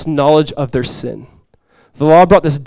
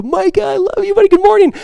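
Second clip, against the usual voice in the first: much higher, tender sing-song morning hello; high, loving parent's voice to a small son; fond greeting to son; 3 dB louder than usual. Retold or imitated. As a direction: greet a little boy you adore, voice way up.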